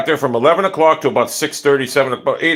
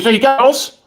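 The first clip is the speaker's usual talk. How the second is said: higher, lightly mocking neighbor voice; a hint of the nagging neighbor asking; imitating neighbor; 10 semitones higher than usual. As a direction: squeak it like the pestering neighbor. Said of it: "He goes".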